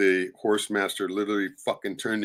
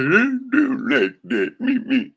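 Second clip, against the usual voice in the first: mock greedy heir; 12 semitones higher, 7 dB louder, slower than usual. Mocking grabby heirs.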